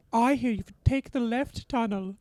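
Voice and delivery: put-on high slow child's Indian accent; a kid's Indian accent from the movie. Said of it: "I like the Indian accent in Indiana Jones".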